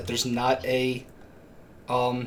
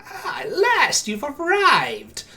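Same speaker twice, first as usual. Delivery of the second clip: put-on high, slow crackling old hag; crackling crone voice; 13 semitones higher, 4 dB louder, much slower than usual. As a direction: drag it out like a withered crone. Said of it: "her voice crackles like dry weeds".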